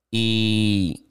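ordinary talk, nothing marked

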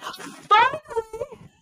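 very high, long-drawn "Finally!"; retold cheer. A fan finally sees her favourite tagged in, cheering high and long. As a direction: let it ring out in relief.

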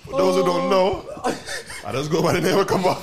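high, loud, laughing through the words; can't get the name out for laughing; cracking up while introducing himself; laughing intro. Real, not acted.

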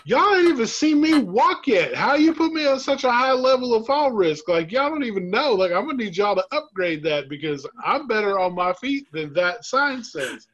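playfully indignant at the fall risk sign; mock outrage. Retelling complaining to hospital staff about his fall risk, mock outraged. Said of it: "I was pissed at my score".

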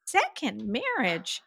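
taken aback, dragging the words out; incredulous echo. Retold or imitated. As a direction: draw it out in mock disbelief. Acting out her surprised reply.

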